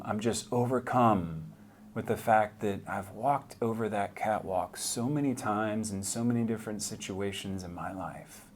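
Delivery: usual speaking voice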